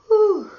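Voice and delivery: a loud, high puff of relief after exertion; breathless whew. Real, not acted. Teacher out of breath, loud high whew. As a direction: blow it out like you're winded. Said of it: "I have to catch my breath".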